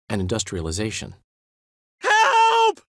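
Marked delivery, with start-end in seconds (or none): 2.0-2.8 high, loud cry of Help!; shout for help. Acted. Calling out for rescue in danger, a loud panicked shout. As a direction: yell it out in panic.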